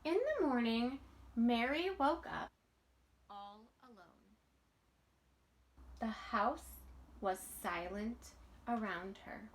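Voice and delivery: put-on lively read-aloud voice for children; storybook reading. A narrator's reading. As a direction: read it like a bedtime picture book. Reading picture book aloud.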